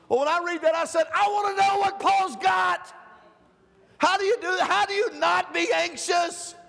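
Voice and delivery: high, loud, shouted preaching; full-force preaching. Acted. Preacher rousing the church about anxiety, shouting. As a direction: pound it out from the pulpit.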